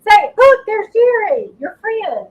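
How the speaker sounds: high, slow sing-song coaxing. Real, not acted. High baby talk to dog.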